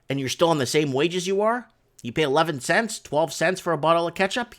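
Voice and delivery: ordinary talk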